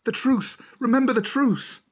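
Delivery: very high, voiced panicky squeal; frightened. A narrator's reading. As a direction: cry out in high-pitched alarm. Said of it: "A frightened whisper quickly carried".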